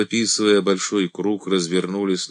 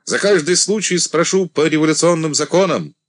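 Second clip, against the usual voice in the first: loud, higher commanding voice; 6 semitones higher, 5 dB louder than usual. A narrator's reading. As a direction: address the whole regiment firmly. Commander addressing regiment.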